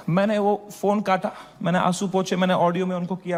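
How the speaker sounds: normal talking voice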